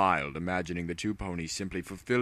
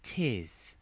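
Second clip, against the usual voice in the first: crooked-smile reply; 4 semitones higher, 5 dB quieter than usual. Acted. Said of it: "a crooked smile".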